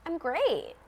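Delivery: small-talk brightness, put on; mock upbeat reply. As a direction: toss it off as a pretend cheery reply. Mimics a stock cheerful answer.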